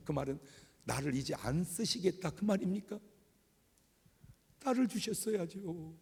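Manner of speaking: retold complaint, rising into a mild whine; arguing with God. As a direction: press God with hurt questions. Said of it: "근데 따졌어요".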